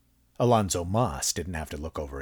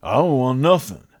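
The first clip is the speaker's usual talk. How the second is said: homeless man voice; 7 semitones higher, 6 dB louder than usual. A narrator's reading.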